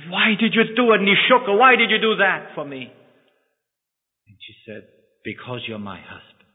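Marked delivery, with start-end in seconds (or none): 0.1-2.9 higher and louder, desperate questioning; anguished outcry. Acted. Husband confronts the wife who gave her cornea, anguished and raised. 5.2-6.5 softer and lower, simple devotion; tender hush. Acted. Wife answers her husband, soft and tender.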